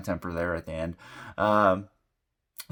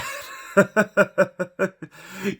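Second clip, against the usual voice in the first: bursting into high laughter; embarrassed laugh; 10 semitones higher than usual. Real, not acted. Laughing at own anger.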